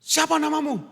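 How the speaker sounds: high, pressing question; sailor questioning. Acted. Sailor demanding Jonah's name, high and pressing.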